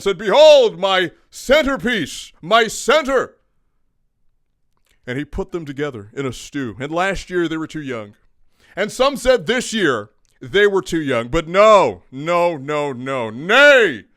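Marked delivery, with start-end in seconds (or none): 0.2-3.4 loud, slow, proclaiming; grand proclamation. Acted. The GM presenting his new center, grandly proclaiming. 13.3-14.0 loud, high "Nay"; defiant proclamation. Acted. The Thunder rejecting doubters, a loud defiant cry.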